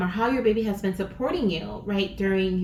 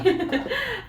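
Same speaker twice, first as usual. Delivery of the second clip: quick high laugh; bright giggle; 12 semitones higher than usual. Real, not acted. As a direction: giggle as you shuffle. Laughs while shuffling.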